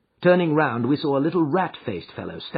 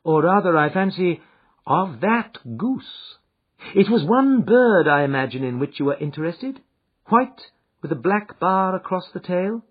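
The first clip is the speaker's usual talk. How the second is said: Holmes, calm and assured; 7 semitones higher, 7 dB louder than usual. Acted.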